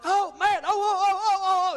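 high, loud pained oh oh oh; moaning in shock at the torn toe; panicked moaning. Retold or imitated. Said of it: "And I was like".

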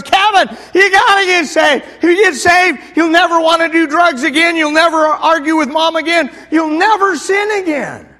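zealous brother begging his sibling, shouting; pleading shout. Retold or imitated. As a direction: grab him and shout it at him.

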